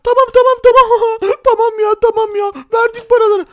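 high, loud, panicked pleading; terrified teller handing over money; begging hostage. Acted. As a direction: beg for your life in a squeaky voice.

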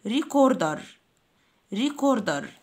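"ريكوردر" with a hard k in the middle. Shown as a demonstration. Teacher models recorder for pupils, C said as K. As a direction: say "ريكوردر" with a clear k. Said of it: "بننطقها كي".

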